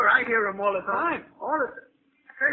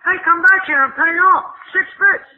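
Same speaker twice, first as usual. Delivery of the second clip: much louder and higher, yelling after him; angry shout; 4 semitones higher, 11 dB louder than usual. Acted. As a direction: holler at the guy skipping the fare. Cabby yells after fleeing fare.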